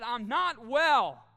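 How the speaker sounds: loud, drawn-out broken admission; slow raw confession. Retold or imitated. Pastor telling his small group, slow loud broken plea. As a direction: force out each word like a confession.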